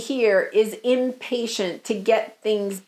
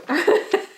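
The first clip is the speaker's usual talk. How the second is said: laughing; 7 semitones higher than usual. Real, not acted. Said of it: "I'm laughing because I don't know".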